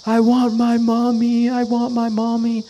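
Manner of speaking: high, whiny toddler wail, imitated; dad voicing his crying son's plea; crying child. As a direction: mimic a four-year-old sobbing for mommy.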